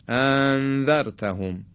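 انذرتهم with the two hamzas merged into one long vowel; hamzas run together. Shown as a demonstration. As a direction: glide through the start of انذرتهم without a second stop. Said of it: "یا اینکه به هم دیگه وصل کنیم".

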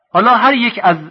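normal talking voice

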